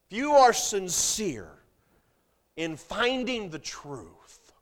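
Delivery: loud, high-pitched preacher calling out news sources; fiery pulpit delivery; full-force preaching. Acted. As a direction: preach it hard to the congregation. Preaching on where to find truth.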